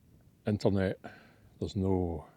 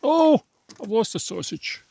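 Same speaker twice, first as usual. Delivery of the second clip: a sudden, much higher and louder yelp; startled shout; 7 dB louder than usual. Real, not acted. Camper losing a sausage off the grill, startled yelp.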